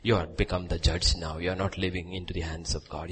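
usual speaking voice

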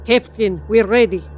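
put-on loud, thick Russian accent. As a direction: report for duty in a heavy Russian accent. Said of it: "in a thick Russian accent".

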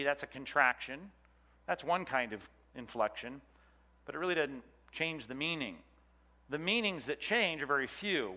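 ordinary talk

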